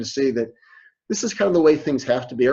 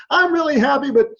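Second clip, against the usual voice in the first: high, loud fake-happy line; forced cheer; 11 semitones higher, 4 dB louder than usual. Lecturer playing a worker faking happiness, forced high cheer. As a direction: sell the happiness you don't feel.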